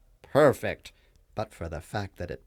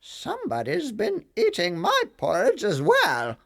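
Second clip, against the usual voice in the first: higher, louder mama bear; a fussy mother bear, pitched up; 11 semitones higher, 6 dB louder than usual. A narrator's reading. Mama Bear finds her porridge touched, high mama voice. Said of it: "Mama Bear looked into her bowl and said".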